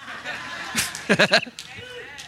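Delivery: high breathy laugh at his own joke; real laughter after a family joke. Real, not acted. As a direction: burst out laughing at the dad bit.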